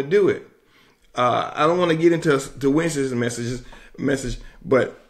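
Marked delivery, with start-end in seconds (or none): none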